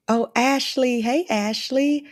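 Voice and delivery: high, slow, delighted hello to a friend; light up greeting her by name; excited greeting. Real, not acted. Greeting a friend who just joined the live call, delighted.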